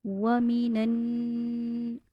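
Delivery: वमीनन very slow, the नन nasalised and held; ghunna recitation. Shown as a demonstration. Teacher shows nasal ghunna on वमीनन, very slow recitation. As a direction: hum the नन of वमीनन through the nose. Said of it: "गुन्ना करना".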